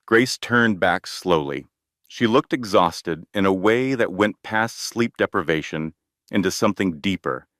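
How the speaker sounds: ordinary delivery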